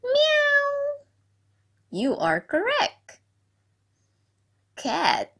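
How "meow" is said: a high, stretched-out meow like a real cat. Shown as a demonstration. Teacher asks the class what a cat is, then meows high. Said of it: "Do you know what is cat?".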